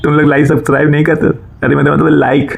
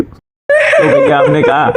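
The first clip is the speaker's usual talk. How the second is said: laughing; much slower than usual. Real, not acted. Laughing while retelling the chore.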